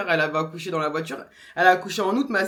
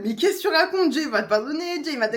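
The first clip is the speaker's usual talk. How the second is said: higher, incredulous protest; mock their outraged objection; imitating them; 7 semitones higher, 4 dB louder than usual. Partner and mother protest her praying, higher incredulous mock. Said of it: "en mode".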